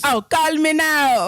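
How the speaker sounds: slow, high Jamaican psychic hotline voice; Jamaican accent. Retold or imitated. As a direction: lay on a Jamaican lilt like a TV psychic. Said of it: "a Jamaican accent to talk like Cleo".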